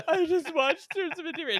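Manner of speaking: high, loud mock sob; retelling calling his wife in tears, mock crying high and loud; imitating drunk crying. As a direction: wail it like a weepy drunk. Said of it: "I'd be crying".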